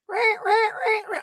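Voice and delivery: high mock rodent squeaking; mouse squeak mimicry. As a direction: squeak it like a tiny trapped animal.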